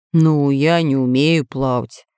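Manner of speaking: pirate admitting he can't swim; sad admission. A narrator's reading. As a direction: sigh it out sadly. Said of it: "грустно заметил пират Бульбуль".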